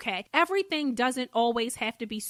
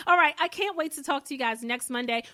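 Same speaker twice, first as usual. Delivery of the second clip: ordinary delivery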